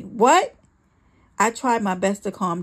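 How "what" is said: loud, high, angry burst; sharp yell, pitched up; yelled question. A narrator's reading. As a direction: snap it as a shout. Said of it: "He yelled at me".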